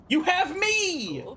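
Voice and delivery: high, drawn-out desperate plea; impassioned acted interruption; desperate outcry. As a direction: cry it out slowly, pitch soaring. Acted plea cutting in on friend.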